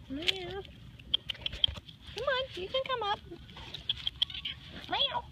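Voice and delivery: high, fast cooing with meows; coaxing meow talk. Real, not acted. Owner meowing at Marvin to lure him up, high cooing. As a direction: mimic the cat to lure it up.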